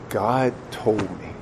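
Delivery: very soft, slow confession; grave hushed answer. Acted. Father explaining to his son, grave hush. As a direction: give the answer slowly, almost under the breath.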